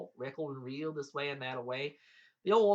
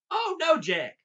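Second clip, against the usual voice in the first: loud high cry of dismay; alarmed protest; 14 semitones higher, 11 dB louder, slower than usual. A narrator's reading. Giant stopping Jack taking the creek, alarmed. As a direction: burst out to stop him.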